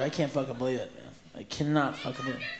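hushed incredulous aside; muttered disbelief. Real, not acted. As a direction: mutter it under your breath.

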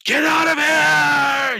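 very high, drawn-out full scream; frantic scream at the top of the voice. Acted. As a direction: scream it and hold the last word. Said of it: "He just starts screaming".

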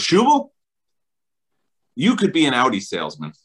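a teasing wife calling her husband. Retold or imitated. Wife teasing her husband about Audi, calling out his name. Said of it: "So she goes".

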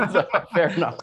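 amused, laughter-filled concession; laughing. Real, not acted. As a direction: say it while still laughing. Laughing at own anecdote.

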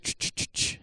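unvoiced "ch" sounds tossed out quickly; imitated scattering noise. Son goes out with the pig food, imitated scattering noise. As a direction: mimic pig feed being scattered.

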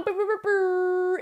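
high playful brr brr noise; rolled lips brr. Pretend. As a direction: trill your lips, silly and slow.

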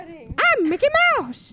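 high, slow cartoon mouse; Mickey Mouse voice. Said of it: "show off [name]'s Mickey Mouse".

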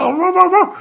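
very high, loud frantic yelps; terrified dog howling. A narrator's reading. Little dog trapped by the lion, panicked high yelping. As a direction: yelp wildly at the top of your range. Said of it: "吓得大叫".

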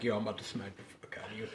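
fast and very soft; retold muttered threat. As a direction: slip the mock threat out under your breath. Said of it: "gives me the side eye".

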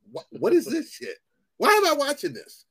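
high-pitched, exasperated disbelief; baffled indignation. Retold or imitated. Moviegoer replaying his reaction to Eternals, high exasperated disbelief. Acting out reaction while watching.

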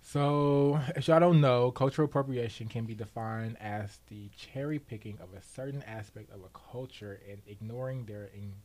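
ordinary delivery

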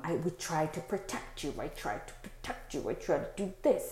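hushed, lightly voiced menacing thought; softly voiced dark thought; dark inner voice. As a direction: imitate a dark voice under the breath. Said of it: "those very dark thoughts".

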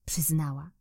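usual speaking voice

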